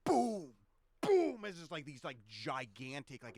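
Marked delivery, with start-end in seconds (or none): 0.0-1.5 loud, high booming bursts; high, punched explosion noises; imitated explosions. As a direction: fire off blasts as vocal sound effects. Game master imitates repeated explosions.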